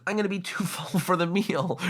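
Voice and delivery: breaking into real laughter mid-line; laughing. Real, not acted. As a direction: laugh through the words, then a short laugh. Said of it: "Sorry, that made me laugh".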